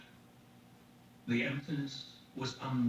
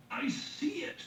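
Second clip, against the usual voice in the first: edge of madness; 13 semitones higher, slower than usual. A narrator's reading. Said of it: "She heard madness in his voice".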